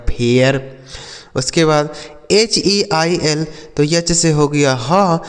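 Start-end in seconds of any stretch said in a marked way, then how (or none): 0.1-0.6 फेयर in two beats, "fay-yar"; air vowel glide. Shown as a demonstration. Teacher shows R changing it, फेयर as "fay-yar". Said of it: "इसे पढ़ा जाएगा".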